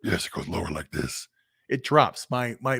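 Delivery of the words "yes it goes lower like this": voice dropping low. Shown as a demonstration. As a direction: drop the voice much lower and softer. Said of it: "my voice starts going down lower".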